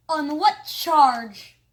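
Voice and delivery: loud, slow indignant demand. Acted. Mayor being handcuffed, loud slow indignant demand. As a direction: demand it with outrage. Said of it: "demanded Mayor Hubble".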